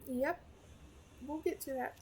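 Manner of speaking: talking through hiccups; hiccup-broken words. Real, not acted. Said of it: "I have the hiccups".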